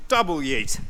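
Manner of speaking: loud playful yell; double yeet shout. Real, not acted. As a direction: hurl it with a cheer. Throwing more junk out.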